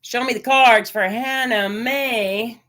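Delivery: slow, solemn calling on the spirits; hushed ritual invocation for the reading; tarot invocation. Acted. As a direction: invoke the cards like a small ritual.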